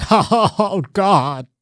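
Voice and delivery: high camp laugh falling into a groan; hammed-up comic actor impression; Paul Lynde. Recalling the great actor's catchphrase, camp mock laughter. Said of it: "he was like".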